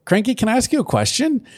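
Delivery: mock Pinkie Pie. Pinkie Pie asking Cranky a question, high and sweet. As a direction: ask it sweetly as the pony.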